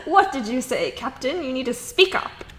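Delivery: loud, high bellow asking to repeat; gruff captain barking over noise; half-deaf shout. Acted. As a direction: make them speak up. Captain cannot hear, asks louder.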